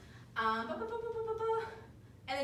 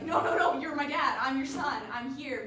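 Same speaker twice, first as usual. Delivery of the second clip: urgent insistence; 2 semitones higher, 7 dB louder than usual. Retold or imitated. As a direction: retell it as an insistent son pleading. Son insisting to confused father.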